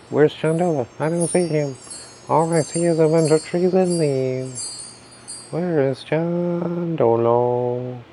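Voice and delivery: high put-on voice, stretching out the last words; playful searcher voice. Pretend searcher hunting the invisible host, high playful voice.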